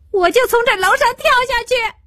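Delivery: very high, rushed outcry; frantic, high-pitched defiance; desperate threat. A narrator's reading. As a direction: fling the threat out fast at top pitch. Girl threatens to jump.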